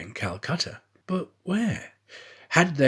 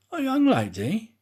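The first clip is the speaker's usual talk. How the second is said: feigned puzzlement; 11 semitones higher, 3 dB louder than usual. Acted. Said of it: "not seeming to comprehend what was said".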